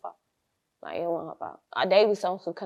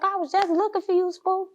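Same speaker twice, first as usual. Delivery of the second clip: high, eager mock-mom voice; excited mom; 10 semitones higher than usual. Mother greeting her dealer on her birthday, eager and high.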